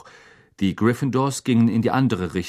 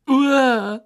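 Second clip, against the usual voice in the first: high retching Uah, louder; disgusted gag; 6 dB louder than usual. A narrator's reading. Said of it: "würgte Lavender Brown hervor". (angewidertes Würgen, hoch und laut)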